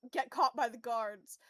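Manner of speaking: ordinary delivery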